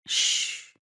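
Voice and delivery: soft whispered shush; whispered shh to quiet her; hushing. A narrator's reading. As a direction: hush her gently. Lover hushes her protest.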